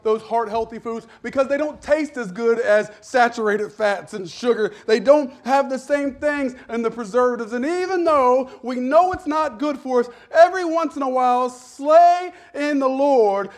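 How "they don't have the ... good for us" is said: usual speaking voice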